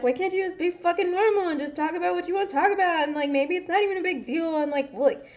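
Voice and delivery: frustrated inner voice. Retold or imitated. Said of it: "part of me that's frustrated".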